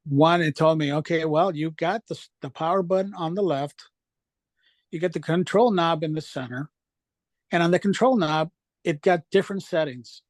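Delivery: ordinary talk, nothing marked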